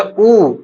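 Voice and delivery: उ drawn out very long; prolonged vowel. Shown as a demonstration. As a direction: hold उ as an extended oooo. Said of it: "हमारा प्लुत".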